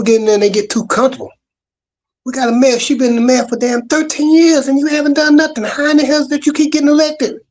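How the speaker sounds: rising, outraged disbelief; exasperated rant. Real, not acted. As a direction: pitch climbing in frustration. Venting about mayor's long tenure.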